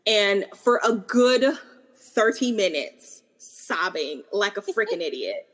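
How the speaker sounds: ordinary delivery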